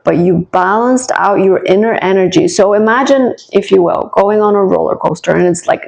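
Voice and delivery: normal talking voice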